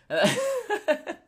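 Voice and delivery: high-pitched helpless laugh. Real, not acted. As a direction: crack up at your own joke. Cracks up at his own joke.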